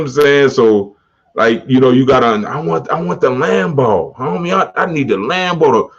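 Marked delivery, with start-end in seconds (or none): 2.5-5.9 eager Lambo wanter. Retold or imitated. Imagined guy begging for a Lambo.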